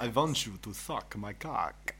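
slow, drawn-out mock Dracula accent, vampire flavour; Count Dracula accent. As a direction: play Count Dracula seducing slowly, half joking. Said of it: "Like Count Dracula?".

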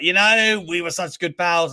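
high, loud hammed-up boasting; mock Hogan boast. Mocking Hogan's claim of friendship with Andre, high loud hammed-up boast. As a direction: puff it up like a revisionist legend. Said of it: "where he's like".

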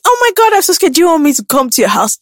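high, hammed-up helpless voice; mock scared. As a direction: act frightened to get an invite. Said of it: "I just message".

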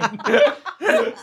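high squeaky laugh of a startled mouse; nervous giggle. Acted. Mouse squeals with nervous laughter. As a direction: giggle out in delight and fright. Mouse character overwhelmed, cackling.

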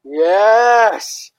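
loud, high cheer; excited cheer. Real, not acted. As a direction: cheer along with full energy. Joining the cheer for Journal Club.

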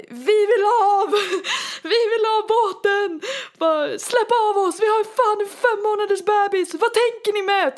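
high, loud, laughing mock yell at the crew; retold angry yelling. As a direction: demand to be let off the boat, half laughing. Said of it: "typ skriker till personalen". (skrattande, hög, arg skrikande imitation)

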